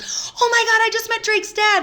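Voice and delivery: high and loud squeal; excited squeal. Retold or imitated. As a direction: burst in gushing. Sister bursts in excited.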